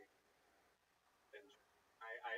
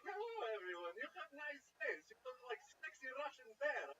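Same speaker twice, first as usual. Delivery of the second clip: put-on higher pitched broken-English Russian accent; 12 semitones higher than usual. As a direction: play a goofy Russian guy with a Russian accent. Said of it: "my inner voice here is just like".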